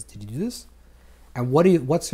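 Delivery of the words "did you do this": lightly voiced inner voice, soft and low; a hushed, slightly lowered question from within. Inner voice asks someone what they did, softly probing. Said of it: "the inner voice says".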